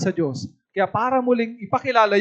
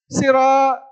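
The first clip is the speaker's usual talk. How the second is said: higher-pitched hawker's cry; mock street-seller calling out wares; imitated vendor's call; 4 semitones higher, 4 dB louder than usual. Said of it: "Ano yung sigaw ng Bicolano?".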